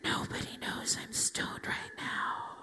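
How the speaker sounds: slow, secretive stage whisper; whispered inner thought. Retold or imitated. Stoned in the bank, thinking to herself, slow whisper. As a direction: whisper it slowly, as a private thought. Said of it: "I'll think to myself".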